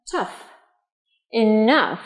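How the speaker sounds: "Tough" and "Enough", each ending on a clear f; final f. Shown as a demonstration. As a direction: model "Enough" slowly apart from "Tough", both with f. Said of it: "thường có thể phát âm là âm f".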